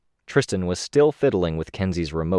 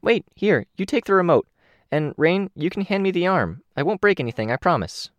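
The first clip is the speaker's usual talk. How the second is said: higher, eager Kenzie voice; bright eager kid character, higher; 12 semitones higher than usual. Acted.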